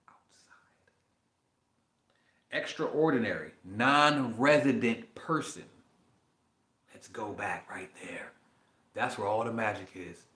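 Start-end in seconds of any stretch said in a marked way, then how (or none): 0.1-0.9 outside said in a soft whisper; whispered repeat. Shown as a demonstration. Savoring the dictionary word, a whispered outside. 6.9-8.3 slow hushed whisper; conspiratorial whisper. Real, not acted. Pointing viewers back to the magic word, whispered. Savoring a definition.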